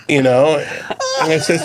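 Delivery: usual speaking voice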